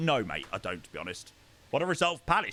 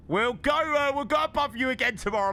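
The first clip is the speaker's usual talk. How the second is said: football banter; 9 semitones higher, 3 dB louder than usual. Real, not acted. Taunting Spurs fan in chat.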